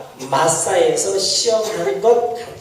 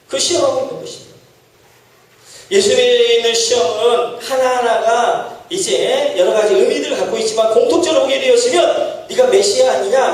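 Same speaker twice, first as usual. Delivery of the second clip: usual speaking voice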